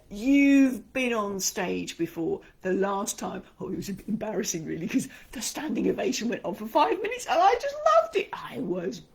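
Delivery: loud, beaming, triumphant boasting; confident and proud. Acted. Coach voicing a confident performer's inner script, loudly proud. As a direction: brag like a star who nailed it. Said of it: "Put yourself in the mindset".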